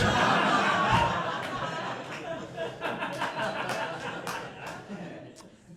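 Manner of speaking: high cackling laugh at the punchline; big laugh. Real, not acted. Comedian laughing at his own story, high cackle. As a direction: burst out laughing, breathless.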